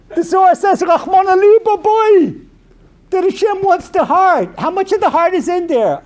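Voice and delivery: high, loud impassioned cry; loud, high fiery sermon voice; fervent preaching. Acted. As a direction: rouse the crowd with heart. Preaching at full force.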